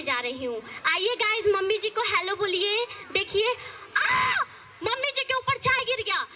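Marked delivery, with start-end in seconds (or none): 4.0-4.4 sudden high shriek; startled yelp. Acted. Daughter-in-law spilling tea on mother-in-law, shrieking.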